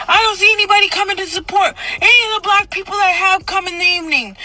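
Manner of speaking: high-pitched ranting outcry; yelling. Retold or imitated. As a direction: rail at the congregation like a frenzied preacher. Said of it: "I started yelling".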